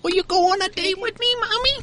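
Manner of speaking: put-on high whiny baby talk. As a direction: talk like a needy toddler. Mocking Pacey as a baby.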